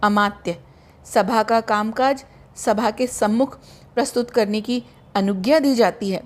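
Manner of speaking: ordinary delivery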